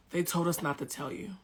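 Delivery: soft, reluctant confession from cousin; real quiet. Retold or imitated. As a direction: break it to them quietly. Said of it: "my cousin gets real quiet".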